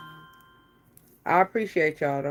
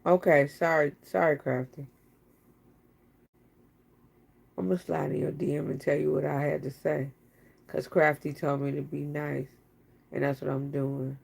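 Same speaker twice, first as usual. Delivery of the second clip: much softer and lower, meek; sheepish apology; 5 semitones lower, 6 dB quieter than usual. Real, not acted. To Crafty after being told off, sheepish apology. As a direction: back down sheepishly.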